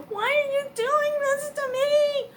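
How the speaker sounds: high, loud, slow plea; anguished cry to God. Retold or imitated. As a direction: cry out why at heaven. Angry at God, crying out.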